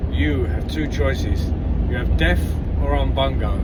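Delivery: slow, grand pronouncement from the chief; a hint of a booming chief. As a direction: lay out the choices like a solemn chief. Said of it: "The chief says to the second bloke".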